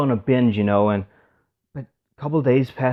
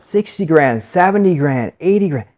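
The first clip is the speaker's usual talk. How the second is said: raised, loud bid calling; auctioneer; 6 semitones higher, 7 dB louder than usual. Retold or imitated. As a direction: call out the rising bids to a crowd. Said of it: "the auctioneer goes up".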